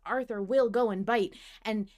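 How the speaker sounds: ordinary talk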